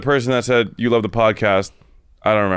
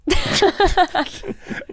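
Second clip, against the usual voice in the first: high giggle. Real, not acted. Host laughs at himself.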